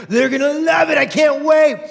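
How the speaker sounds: high, eager gift-giver; excited. Retold or imitated. Giver before the gift opens, high and excited. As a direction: bubble over with anticipation. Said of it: "and you are so excited".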